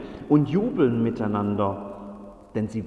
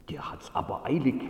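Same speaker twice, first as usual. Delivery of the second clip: tonlos geflüstert; Flüstern; 4 dB quieter, faster than usual. Acted. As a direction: heimlich tuscheln. Wächter tuscheln über den Boten. (geflüstertes Tuscheln)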